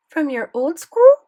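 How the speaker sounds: put-on high, loud question in French accent; surprised query with a thick French accent; French-accented grandmother. A narrator's reading. Grandmother asking about his old school, French accent. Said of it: "Grandmere had a thick French accent".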